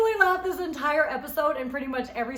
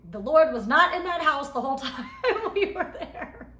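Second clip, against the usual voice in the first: laughing, voice jumping higher; 5 semitones higher, 5 dB louder than usual. Real, not acted. Joking to viewers about the godless house, laughing. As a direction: crack up mid-sentence.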